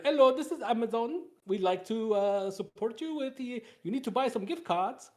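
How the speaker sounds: a fake Amazon support caller in a put-on accent; playful mock call-center accent pushing gift cards. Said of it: "my immediate go-to with that accent".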